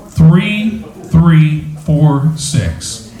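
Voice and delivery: very slow, each digit separated; slow number call. Real, not acted. Raffle host reading out the winning number, slowly and deliberately. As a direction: announce each digit with long gaps.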